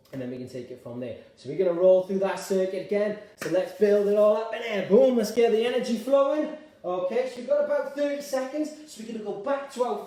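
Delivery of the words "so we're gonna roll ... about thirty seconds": loud, building higher as it goes; big coach's call to action; rallying the class. Real, not acted. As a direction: pump up the energy for the next round. Rallying class for next circuit.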